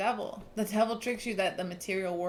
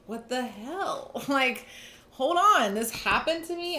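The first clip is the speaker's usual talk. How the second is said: a slow "what the hell", then shooting up high and loud in disbelief; stunned outrage; 6 semitones higher, 5 dB louder than usual. Retold or imitated. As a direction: act out the client's shocked, angry disbelief. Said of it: "You're like".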